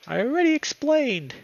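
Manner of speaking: put-on loud, drawn-out childish whine; high, slow, exasperated whine; high-pitched whiny voice. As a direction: wail it like a whining child. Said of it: "with a high-pitched, whiny voice".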